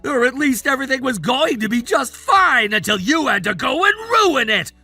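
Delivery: indignant outburst. Acted. Man blaming a rival for ruining his plan, indignant outburst. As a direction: rant like your plan was wrecked. Acted quarrel, son blames the accuser.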